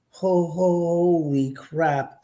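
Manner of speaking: slow, stammered awe; dumbfounded, slowed down; stunned. Retold or imitated. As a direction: stammer it out in disbelief. Stunned at the murder house site.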